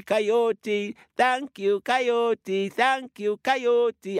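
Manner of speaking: high, gloating little turtle voice; triumphant Baby Turtle. Acted. Baby Turtle gloating at Coyote from a rock, high and cheeky. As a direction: crow over the fooled trickster.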